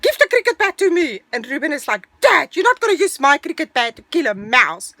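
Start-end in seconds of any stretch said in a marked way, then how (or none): 0.0-1.2 high, loud, fast ordering; retold quarrel. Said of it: "starting to fight". 2.2-4.9 loud, higher-pitched retort; retold quarrel. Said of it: "starting to fight".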